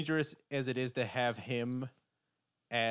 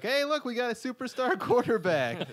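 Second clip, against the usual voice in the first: high, fast hyped-up announcer voice; excited sales pitch; 11 semitones higher, 4 dB louder than usual. Retold or imitated. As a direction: sell it like a giddy team owner.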